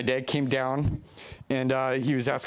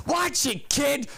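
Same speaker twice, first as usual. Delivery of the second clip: gruff stranger; 11 semitones higher, slower than usual. Retold or imitated. Gruff figure to lost kid.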